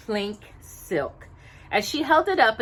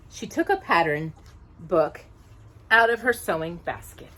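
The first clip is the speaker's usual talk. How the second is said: ordinary delivery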